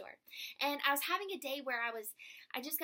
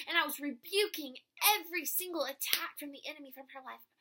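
fervent; 4 semitones higher, 7 dB louder than usual. Real, not acted. Recounts passionate prayer.

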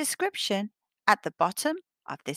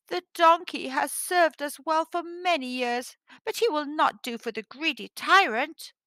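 higher-pitched, plaintive sorrow; said sadly; 6 semitones higher than usual. A narrator's reading. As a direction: read it as a sad, reluctant farmer. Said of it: "Mustafa said sadly".

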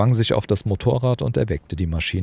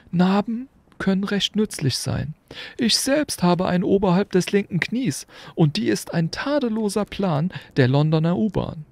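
put-on high, kindly old wizard, lightly curious; an amused old headmaster asking; Dumbledore; 13 semitones higher than usual. A narrator's reading. Dumbledore questioning Hagrid about the motorbike, amused.

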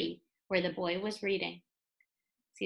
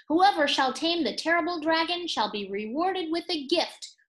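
royal proclamation; 6 semitones higher, 4 dB louder than usual. Acted. King's proclamation read aloud.

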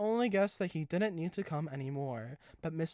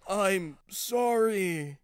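slow, drawn-out halting apology; mildly stuttering, dragged-out delivery; stammered apology; 5 semitones higher, 7 dB louder, much slower than usual. A narrator's reading. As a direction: falter slowly through the apology. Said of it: "he stuttered".

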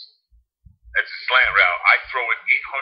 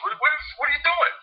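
much higher what what what; surprised staffer. Retold or imitated. Staffer finds player hiding in shower, high startled voice.

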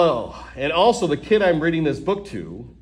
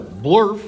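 silly nonsense word; 5 semitones higher, 5 dB louder than usual. Acted. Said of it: "Wait a second, what?".

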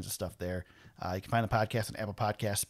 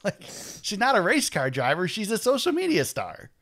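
rising higher and louder to drive it home; exasperated insistence; 8 semitones higher, 5 dB louder than usual. Real, not acted. Insisting on point about driver.

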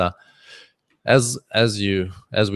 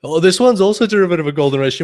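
higher, faster and louder discovery; excited trader; 7 semitones higher, 4 dB louder, faster than usual. Retold or imitated. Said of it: "You're like".

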